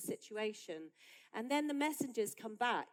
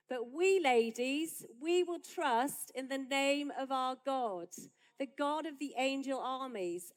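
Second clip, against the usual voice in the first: much louder, slow and proclaiming; rousing declaration; 4 semitones higher, 8 dB louder than usual. Acted. Preacher rallying the ladies with scripture, loud and slow. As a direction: declare it like a battle cry.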